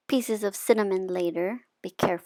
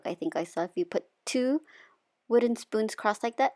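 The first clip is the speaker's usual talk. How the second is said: usual speaking voice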